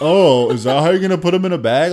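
high, loud nagging mimicry of a critic; mocking nagging voice. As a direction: mimic a pestering know-it-all. Mocking nagging onlooker again.

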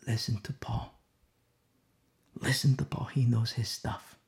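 softly, with reverence; quiet earnest quote. Retold or imitated. Preacher quoting Peter's humble advice, soft and earnest. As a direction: hush it like a humble elder. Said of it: "he says".

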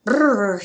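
cold, loud brrr through the teeth; shivering brrr. Acted. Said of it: "he said with both wings held".

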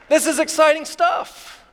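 excited. Real, not acted. Preacher winning over the congregation after teasing Rick, excited. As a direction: let the excitement lift your pitch. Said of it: "I'm exci-, are you excited?".

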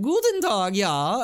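high, hammed-up German accent greeting; mock German accent. Team Rocket rowing up, greeting in a mock German accent. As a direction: ham up a cartoonish German accent. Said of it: "an accent".

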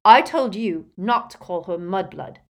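loud, angry rebuke; snarl. A narrator's reading. Boy scolds the elf for an insult, loud snarl. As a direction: snarl it as a telling-off. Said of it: "snarled Harry".

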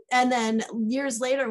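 ordinary talk, nothing marked

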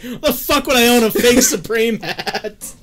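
high, loud, drawn-out mock outrage; hammed-up indignant yell; imitating angry friend. Imitating friend roasted over his hat, mock furious. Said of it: "seen you, like, get mad".

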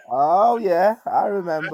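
loud, sheepish sudden realization; sudden remembering. Retold or imitated. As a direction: blurt it as if just caught out. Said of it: "you'll be like".

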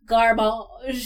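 garbage stretched out as gar-BAHZH; fancy pronunciation. Pretend. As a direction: say garbage like a French word, slowly. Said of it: "You are not garbage. You are garbage".

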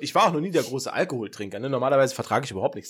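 normal talking voice

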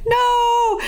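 very high, stretched-out mock "no"; mock wail of refusal. Pepper Ann refusing Nikki's grand piano, mock wail.

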